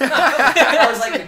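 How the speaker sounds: high, slow, loud laughing burst; cracking up mid-sentence; laughing hard. Real, not acted. Laughing recalling argument.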